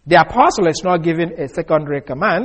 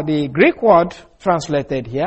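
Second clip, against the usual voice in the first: ordinary talk, nothing marked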